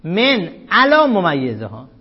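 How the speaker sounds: علا said slowly and stressed, with a rise at the end; slow corrected reading of علا ممیزها. Shown as a demonstration. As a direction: read علا clearly, not as علیین. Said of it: "نخونید من علیین".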